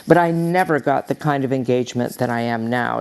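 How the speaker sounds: normal talking voice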